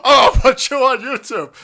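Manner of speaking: high, loud laughing quote; mock him through laughter. Quoting the ranter's threat while laughing. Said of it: "They'll go".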